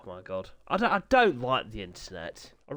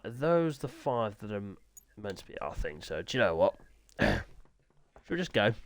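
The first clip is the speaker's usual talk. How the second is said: usual speaking voice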